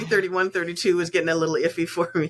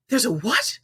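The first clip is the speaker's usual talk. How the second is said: shocked question; 7 semitones higher than usual. Retold or imitated. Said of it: "he looked so shocked".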